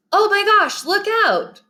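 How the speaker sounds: very high, urgent warning cry; excited exclamation. Shown as a demonstration. Teacher giving an exclamation point example, excited warning cry. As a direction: exclaim it in high-pitched alarm. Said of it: "want someone to sound exciting".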